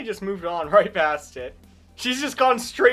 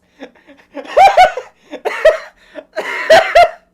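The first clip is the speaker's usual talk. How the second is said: loud squealing laughter; high shrieking laugh; 16 dB louder than usual. Real, not acted. Laughs at game event.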